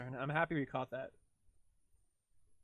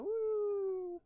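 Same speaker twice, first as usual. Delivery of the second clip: whoop. Real, not acted. Celebrating getting the word.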